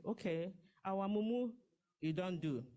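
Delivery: ordinary talk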